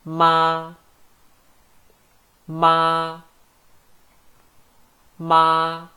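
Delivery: 妈 long, high and level; first tone. Shown as a demonstration. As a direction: stretch ma flat on a high note. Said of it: "first tone".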